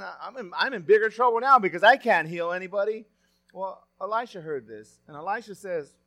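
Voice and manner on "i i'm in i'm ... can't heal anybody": loud, panicky cry of a frightened king; mock-panicked shout. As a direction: throw up your hands in alarm as the king. Panicked king voiced in story.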